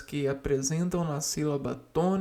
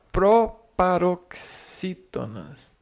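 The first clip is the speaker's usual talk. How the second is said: proparoxítonas drawn out slowly and louder, syllable by syllable; slow emphatic term; 7 dB louder, much slower than usual. Shown as a demonstration. Teacher to class introducing the new term, slow and loud. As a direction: spell out proparoxítonas slowly and firmly. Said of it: "A acentuação das palavras".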